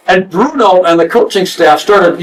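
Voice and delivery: ordinary talk, nothing marked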